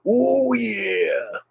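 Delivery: high stretched "Oh, yeah" in Macho Man style. Pretend. Streamer showing off a Macho Man catchphrase, high and drawn out. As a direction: draw out the oh like a wrestling promo. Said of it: "I can usually do him".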